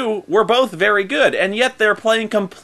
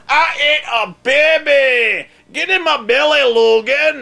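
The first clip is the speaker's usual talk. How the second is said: high, slow, shouted Blob impression; 9 semitones higher, 4 dB louder, slower than usual. Mocking the Blob from the Wolverine movie, hammed-up shout. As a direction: bellow it like a greedy blob monster. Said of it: "the actor playing Blob".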